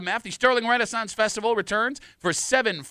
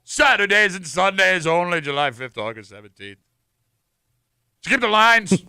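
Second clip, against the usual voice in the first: medieval voice; 7 dB louder than usual. Acted. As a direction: proclaim it like a town crier. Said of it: "my medieval voice".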